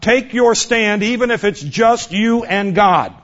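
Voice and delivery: usual speaking voice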